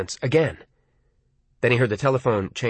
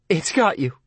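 tearful, wavering; 3 semitones higher than usual. Acted. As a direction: voice wavering toward tears. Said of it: "her voice was wavering toward tears".

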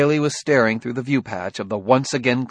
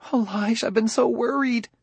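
high, anxious woman's voice; fretting wife relieved to reach her husband; worried wife; 13 semitones higher than usual. Acted.

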